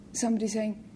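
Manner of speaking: ordinary talk, nothing marked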